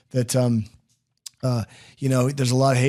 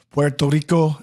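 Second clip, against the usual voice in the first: attempted Spanish pronunciation of Puerto Rico; a light, clumsy Puerto Rican accent; bad Puerto Rican accent; 2 semitones higher, 3 dB louder than usual. Host naming where the husband is from, attempted Puerto Rican accent. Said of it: "that's a terrible accent".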